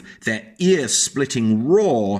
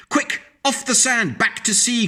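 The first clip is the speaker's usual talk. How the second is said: high urgent little snail voice; snail crying out; 11 semitones higher than usual. A narrator's reading. Snail urging the stranded whale back to sea, high urgent cry. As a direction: cry it out, much higher, pleading. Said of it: "cried the snail".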